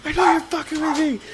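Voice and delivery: very high, loud mock outburst; imitated screaming. Brother accusing his would-be defender, imitated scream. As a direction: shriek it like an enraged brother. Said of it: "screaming the top of his lungs".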